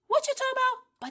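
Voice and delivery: very high, loud confused voice; baffled stranger. Acted. Stranger not understanding the word, high loud bafflement. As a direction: squawk it in high-pitched confusion. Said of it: "you ask me".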